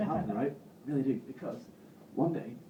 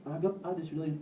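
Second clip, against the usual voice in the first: normal talking voice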